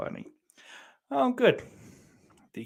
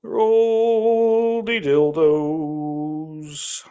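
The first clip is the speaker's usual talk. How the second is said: "Roll the dildos" stretched very slowly, louder; extremely drawn out; 4 semitones higher, 6 dB louder, much slower than usual. Pretend. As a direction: intone it like a grand announcement, crawling. Mock-grand drawn-out nickname.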